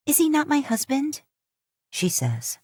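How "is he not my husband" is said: Mila pleading, voice lifted; young wife protesting, pitched up; higher young woman's voice. A narrator's reading. Mila challenging the chief, raised voice.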